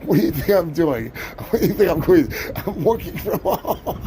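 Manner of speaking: ordinary delivery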